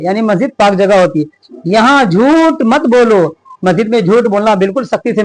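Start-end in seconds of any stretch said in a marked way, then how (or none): 1.7-3.4 each word read out slowly and evenly; slow lesson reading. Shown as a demonstration. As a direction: read it aloud clearly for young pupils. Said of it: "reads the lesson text slowly". (बच्चों को पाठ पढ़कर सुनाते हुए, धीरे-धीरे)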